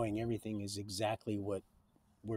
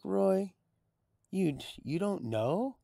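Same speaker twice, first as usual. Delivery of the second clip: higher, amazed disbelief; incredulous friend; 9 semitones higher, slower than usual. Retold or imitated. Neighbor asking Roy, a touch of incredulity. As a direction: retell his stunned question.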